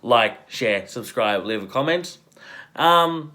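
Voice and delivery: normal talking voice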